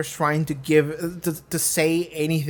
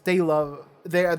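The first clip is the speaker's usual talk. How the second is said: usual speaking voice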